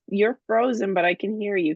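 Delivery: ordinary talk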